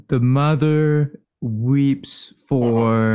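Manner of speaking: very slow, word by word; laboured reading of a barely known language; halting translation. Acted. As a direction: piece the sentence together slowly as you read. Halting translation after "sound it out.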